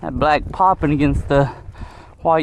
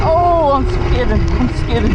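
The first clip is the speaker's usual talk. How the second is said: alarmed excitement; 7 semitones higher, 4 dB louder than usual. Real, not acted. Bike skidding on snow.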